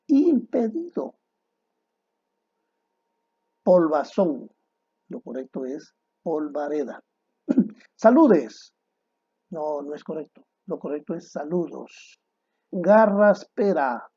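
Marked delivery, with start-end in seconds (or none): 0.1-1.1 impedido said much higher and slower, insisting; forma correcta enfatizada. Shown as a demonstration. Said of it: "No, lo correcto es". 12.7-14.1 Garraspera said slowly and louder, with a hard "g"; forma incorrecta. Shown as a demonstration. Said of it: "Lo correcto es carraspera".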